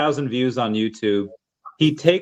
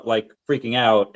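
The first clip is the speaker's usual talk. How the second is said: ordinary delivery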